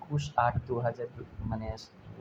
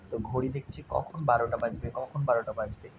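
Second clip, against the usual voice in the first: normal talking voice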